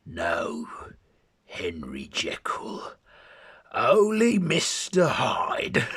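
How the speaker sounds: Cockney sneer rising to a high, loud, slow finish; strong Cockney. Acted. Hyde taunting his other self, strong Cockney voice. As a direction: hit the Cockney hard and end big. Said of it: "he then says in a strong Cockney voice".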